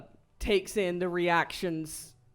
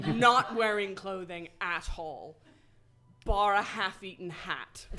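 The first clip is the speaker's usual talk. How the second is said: ordinary talk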